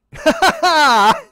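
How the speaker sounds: loud, high incredulous laugh; high burst of laughter. Real, not acted. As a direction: crack up in disbelief. Laughing at clerk's Cadillac pitch.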